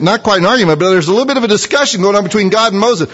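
ordinary talk, nothing marked

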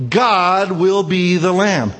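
ordinary delivery

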